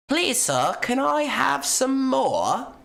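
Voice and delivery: pleading orphan voice. Pretend. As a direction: whimper it up like a starving orphan holding out a bowl. Begging plea quoted in jest.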